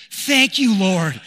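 loud, high burst of thanks; a father's happy shout of gratitude; joyful thanks. Retold or imitated. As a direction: cry out thanks to heaven, delighted. Father's grateful outburst.

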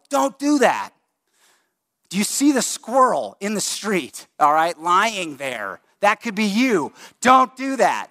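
father yanking his daughter back from traffic, sharp yell; frantic yell. Retold or imitated. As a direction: yell at a child stepping into traffic. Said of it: "and yelled".

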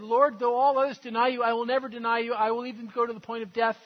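bold vow. Retold or imitated. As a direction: declare it like a proud oath. Peter's boastful vow retold.

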